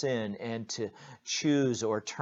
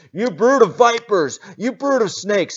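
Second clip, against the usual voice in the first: much higher, much louder yell; John yelling at the crowd, furious yell; 11 semitones higher, 16 dB louder than usual. Acted. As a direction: scream it like a wild prophet.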